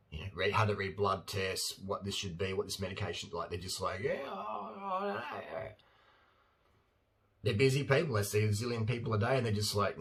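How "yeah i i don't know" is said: much higher, softer dithering voice; mock a doctor who has no idea; clueless doctor.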